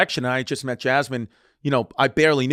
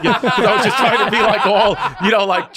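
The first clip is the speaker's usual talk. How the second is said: high, loud, breaking into laughter; laughing through the words; 6 semitones higher, 6 dB louder than usual. Real, not acted. Comedian telling his own embarrassing story, laughing. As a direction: talk while cracking up.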